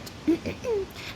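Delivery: high, nervous little throat clear. A narrator's reading. Wizard readying his magic, clearing his throat. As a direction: clear your throat before performing. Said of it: "He cleared his throat".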